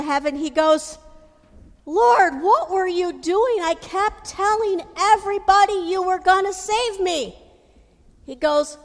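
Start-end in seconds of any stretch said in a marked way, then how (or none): 1.9-7.4 indignant complaint. Acted. Drowned man complains to God.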